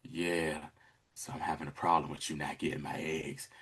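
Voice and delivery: low, hushed menace; imitating a villain's very low, soft voice; voice gets real. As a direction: drop right down, quietly threatening. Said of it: "you hear Moro's voice get real".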